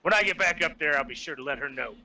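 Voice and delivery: very low, measured character voice; friar voice. Acted. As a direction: play the friar far down in pitch. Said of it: "be like".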